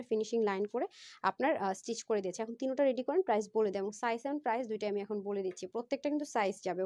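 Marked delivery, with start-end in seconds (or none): none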